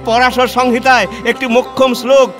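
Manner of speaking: high, exultant declaiming; jubilant discovery. Acted. Reformer finds the verse backing widow remarriage, jubilant. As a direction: cry out the find in triumph, pitch held high.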